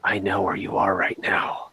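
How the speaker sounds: creepy low whisper. Shown as a demonstration. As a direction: whisper it like a stalker. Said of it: "I pick up the phone and I say".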